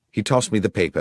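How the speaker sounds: ordinary delivery, nothing marked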